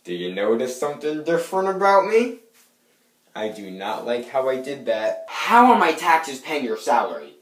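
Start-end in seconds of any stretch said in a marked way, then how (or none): 0.0-2.4 loud call, then a climbing question; hammed-up fishing for a compliment, rising high; showy greeting. Acted greeting, disliked afterwards. 5.3-7.3 loud, high-pitched indignant demand; outraged. Acted. Acted outraged complaint.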